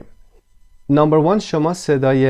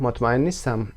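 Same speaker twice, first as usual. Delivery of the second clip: ordinary talk, nothing marked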